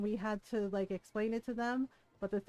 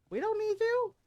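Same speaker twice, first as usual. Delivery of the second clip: higher, louder firm dismissal; defiant retort; 12 semitones higher, 4 dB louder than usual. Retold or imitated. Telling the man women don't need him, higher and loud. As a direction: slam the door on him.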